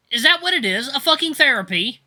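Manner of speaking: high, loud sarcastic mockery; snarky mocking. A narrator's reading. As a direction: scoff at the idea out loud. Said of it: "I mock him, snark clear in my voice".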